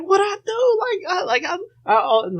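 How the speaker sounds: put-on high, crying kid whine. Boy crying to his mom, high whine. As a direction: whimper it high like a wronged child. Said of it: "And I start crying".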